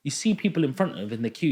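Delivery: normal talking voice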